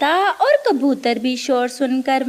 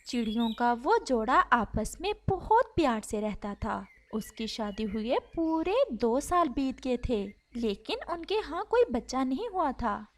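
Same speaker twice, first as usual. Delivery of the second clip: much softer, gentle storytelling voice; soft story narration; 3 semitones lower, 8 dB quieter than usual. A narrator's reading. A narrator softly tells a sad bird story, hushed. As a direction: read it softly like a bedtime tale.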